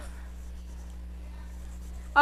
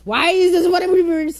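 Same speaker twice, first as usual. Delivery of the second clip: exasperated cry; 6 semitones higher, 5 dB louder than usual. Real, not acted. Car reversing on its own.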